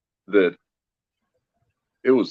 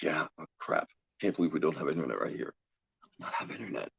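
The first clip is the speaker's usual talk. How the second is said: quiet, quick worried muttering under the breath; hushed fast muttering; 3 semitones lower, 10 dB quieter, faster than usual. Retold or imitated. Officiant fretting to himself about no internet, muttered fast and hushed. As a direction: fret softly to yourself, fast.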